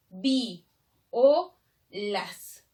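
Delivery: "vi, o, las" as three separate beats; diéresis, diphthong broken. Shown as a demonstration. Teacher showing the diéresis, "vi, o, las" in separate syllables. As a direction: split "vi" from "o" with a clear pause. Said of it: "le coloco la diéresis".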